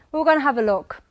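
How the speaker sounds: ordinary talk, nothing marked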